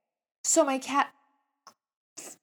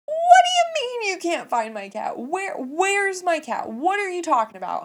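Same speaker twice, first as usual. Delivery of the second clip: high, loud screech of disbelief; frantic retold yelling, stretched and pitched way up; panicked outburst; 9 semitones higher, 8 dB louder than usual. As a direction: shriek the questions in hammed-up panic. Panics on hearing cat is lost.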